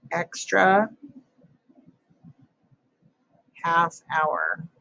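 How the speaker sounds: dictating. Shown as a demonstration. Writing sentence word by word.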